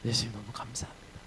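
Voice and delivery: 예수님, 너무 감사합니다 breathed in a whisper, 예수님 rising; hushed, breathless thanks. Acted. Paul in prison whispering thanks to Jesus.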